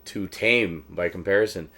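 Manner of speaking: ordinary talk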